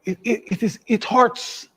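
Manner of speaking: strained, pained cry; anguished. Real, not acted. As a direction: voice rising in distress. Caller pained by rising costs.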